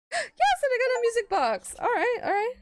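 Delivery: high, loud victory squeal; excited cheer. Real, not acted. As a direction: celebrate the win. Winning a prize.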